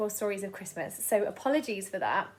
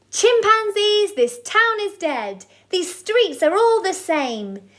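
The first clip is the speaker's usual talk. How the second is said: rousing speech; 7 semitones higher, 9 dB louder than usual. Acted. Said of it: "he said".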